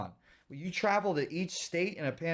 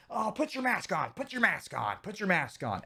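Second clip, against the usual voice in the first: mock panicky nag; 6 semitones higher than usual. Mocking panicked Boston people.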